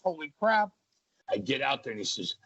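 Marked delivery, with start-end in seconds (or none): 0.0-0.7 higher pitched, retold disbelief; stunned. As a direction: replay your own shock at the big fall. Retelling his panic in the ring.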